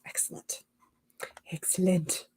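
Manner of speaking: soft whispered praise. Real, not acted. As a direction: breathe it out quietly. Whispered repetition.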